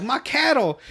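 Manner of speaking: high, anguished cry; rancher's cry. Retold or imitated. Rancher crying out as his cows flee, high and anguished. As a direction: wail for the lost herd. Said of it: "The ranch is like".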